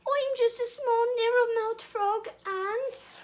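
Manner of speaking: very high, squeezed little voice; high-pitched innocent small frog; tiny puckered-mouth frog. Acted. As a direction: squeak it through pursed lips. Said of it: "puckering his mouth into the smallest".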